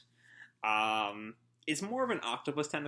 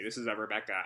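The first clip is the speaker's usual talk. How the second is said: usual speaking voice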